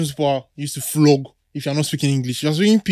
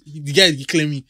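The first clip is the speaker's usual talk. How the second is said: ordinary delivery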